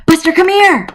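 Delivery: put-on girl calling her new dog to her side. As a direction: call the dog over brightly. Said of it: "Alex called".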